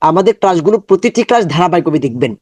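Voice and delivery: ordinary talk, nothing marked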